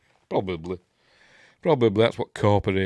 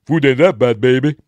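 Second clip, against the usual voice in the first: louder mock wisecrack; loud mock quip at the table; jokey Spaceballs voice; 4 semitones higher, 13 dB louder than usual. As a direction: crack the joke loud to the choking man. Joking quote, Spaceballs reference.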